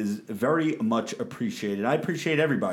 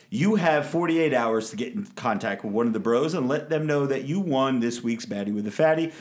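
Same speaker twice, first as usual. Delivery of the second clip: ordinary delivery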